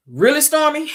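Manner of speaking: high-pitched mock disbelief; incredulous. Melody looking at Stormy, imitated incredulity.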